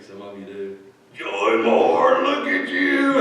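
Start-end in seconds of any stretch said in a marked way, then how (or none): 1.1-3.2 big loud greeting, pitch leaping up; Larry. Retold or imitated. Patient greets her as she walks into the room, loud. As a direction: boom out a delighted hello. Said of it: "And you know Larry".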